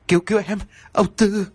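high, stuttering cry for help; wife stammering the dead man's name in fear; terrified stammer. Acted. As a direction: gasp it out in terror. Wife terrified, naming the ghost.

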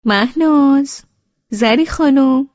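high, loud, slow call across the house. A narrator's reading. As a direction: call the girls from the other room. Mother calls out from another room. (صدا زدن بلند از دور)

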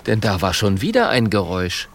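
ordinary delivery, nothing marked

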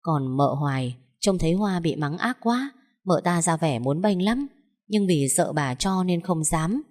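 usual speaking voice

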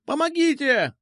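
very high squealed plea; squealing. A narrator's reading. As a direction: squeal for help at the top of the range. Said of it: "завизжал он". (пронзительно визжать)

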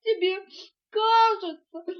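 high, loud tearful wail; sobbing. A narrator's reading. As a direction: break the words up with sobs. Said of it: "всхлипнула мартышка". (всхлипывая)

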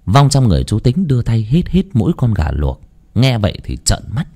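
ordinary delivery

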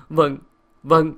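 wounded servant giving in; hurt compliance. A narrator's reading. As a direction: answer curtly while upset. Upset servant answers dismissal.

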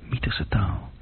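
hushed whisper under the breath; muttered whisper; whispered aside. Acted. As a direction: whisper it to herself.

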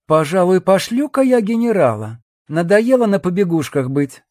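high-pitched, self-willed female character voice; scheming woman. A narrator's reading. Woman deciding to ditch the general, airy defiance. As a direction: voice a sly woman, airily fed up.

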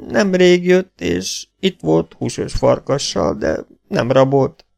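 high, slow broken speech; wounded half-breed. Acted. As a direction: drag each word out in pain. (magas, lassú, tört beszédű sebesült)

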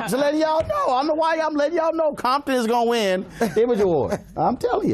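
high-pitched, emphatic warning; playful insistence. Real, not acted. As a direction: pitch it up and insist to the whole panel. Host campaigns for favorite film.

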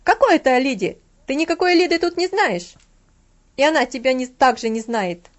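put-on Frau Troll scolding the boy, indignant; furious indignant shouting. A narrator's reading. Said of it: "закричала госпожа Тролл с негодованием".

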